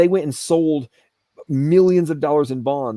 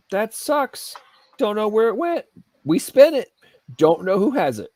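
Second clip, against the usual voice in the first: mock official; 8 semitones higher, slower than usual. Mocking government shrug.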